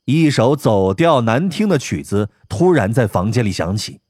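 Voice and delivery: ordinary delivery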